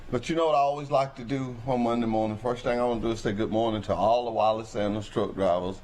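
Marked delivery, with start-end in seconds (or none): none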